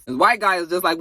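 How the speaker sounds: ordinary talk, nothing marked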